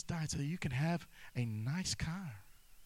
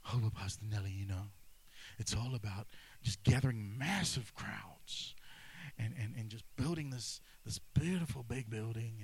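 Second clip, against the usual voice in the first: low, hushed parody of a preacher chasing crowds; mock smug pastor; 7 semitones lower than usual. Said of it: "but our model is Jesus".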